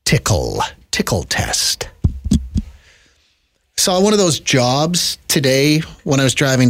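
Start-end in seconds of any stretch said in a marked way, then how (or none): 0.0-0.6 breathy whispered mic test; ASMR whisper. Shown as a demonstration. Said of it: "in my A S M R voice again". 0.9-1.9 whispered, soft and intimate; ASMR whisper. Shown as a demonstration. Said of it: "in my A S M R voice again".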